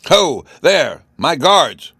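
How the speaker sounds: loud, high call for the guards; high-pitched summoning shout; crying out. A narrator's reading. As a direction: call out like an enraged king. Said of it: "he cried".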